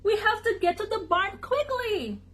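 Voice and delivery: high, urgent duck voice; high-pitched duck warning; quacked alarm. A narrator's reading. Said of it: "ducks quacked".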